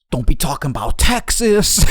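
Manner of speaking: loud, high mock yell; crazed outburst. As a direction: imitate a guy going berserk. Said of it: "made this other guy go fucking crazy".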